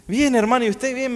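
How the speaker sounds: saludo agudo y forzado; voz fingida de apuro. Retold or imitated. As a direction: finge que todo va bien, con voz aguda. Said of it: "le dije con esa voz así". (cortesía aguda y fingida)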